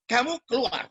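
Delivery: high-pitched, firm get-out command; retold eviction order. Preacher repeating the eviction to the girl, retold command. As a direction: re-enact ordering someone to leave. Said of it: "Saya usir".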